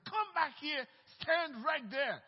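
loud, high, forceful retold order; acted angry shout, each word driven hard; angry command. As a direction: re-enact ordering a demon about. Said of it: "I was angry".

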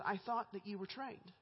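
ordinary delivery

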